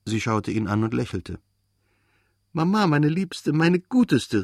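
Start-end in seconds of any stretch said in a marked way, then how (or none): 2.5-4.4 put-on high, adoring child's exclamation; delighted cry. A narrator's reading. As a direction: call out in a burst of love, pitched high. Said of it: "rief er". (begeistert und hoch gerufen)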